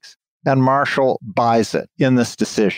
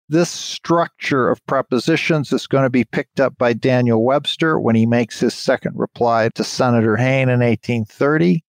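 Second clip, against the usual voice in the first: normal talking voice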